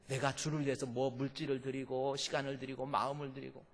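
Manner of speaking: normal talking voice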